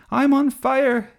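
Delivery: high, loud, drawn-out cry of alarm; melodramatic shout. A narrator's reading. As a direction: shout it like a kid playing at burning. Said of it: "he shouted".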